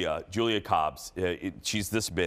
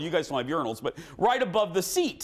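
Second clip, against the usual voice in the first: much higher mimicked friend voice; mimicking friend; 12 semitones higher than usual. Friend explaining the billboard in the stall, higher mimic. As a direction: explain it breathlessly. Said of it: "And she says".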